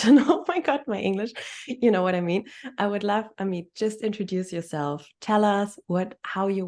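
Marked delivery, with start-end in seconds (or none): 0.0-1.3 bursting into a laugh, higher and louder; real laugh through the words. Real, not acted. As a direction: laugh it out at yourself, embarrassed. Laughing at her own English.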